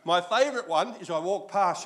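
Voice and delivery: ordinary talk, nothing marked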